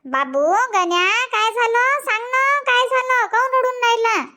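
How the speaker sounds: put-on high, sweet coaxing to a crying boy; coaxing tender.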